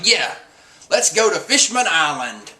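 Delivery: rally them, imitating an eager captain; mildly rousing cheer. Luffy cheering the crew on, lightly acted excitement.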